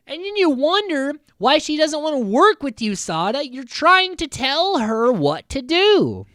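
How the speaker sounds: high, loud, incredulous rebuke; exasperated scolding. Acted. Recapper scolds Sada for bossing another girl, exasperated. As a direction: tell them off at high pitch, dragging out the last words. Exasperated retort to the girls' complaint.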